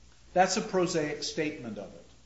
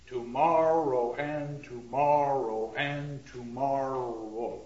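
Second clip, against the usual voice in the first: slow, each stress in Tomorrow and tomorrow and tomorrow hammered; pounding the rhythm; slower than usual. Shown as a demonstration. Teacher pounds out the rhythm of the first line slowly. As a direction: beat out da-DUM on every tomorrow. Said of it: "pound out the rhythm".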